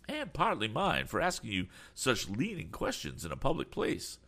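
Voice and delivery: put-on high, fussy old gentleman voice; flustered, eager old man; eccentric old gentleman. A narrator's reading. Mister Damon voiced.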